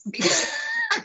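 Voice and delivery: sudden high laugh; high burst of laughter. Real, not acted. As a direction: laugh at her own confession. Laughs at paying him back.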